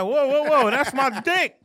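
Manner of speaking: high, loud whoa; loud surprised bro. Retold or imitated. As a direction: yell it in mock alarm. Acting out Rafizi.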